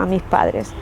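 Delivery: ordinary talk, nothing marked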